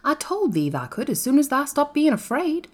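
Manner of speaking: high-pitched Yorkshire lad; Dickon, Yorkshire country boy. A narrator's reading.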